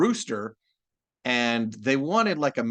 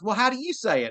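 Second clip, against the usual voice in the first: higher-pitched mock Texan sister; imitating sister, Texan; 10 semitones higher than usual. Sister challenging the voice actor, imitated in Texan. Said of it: "She goes".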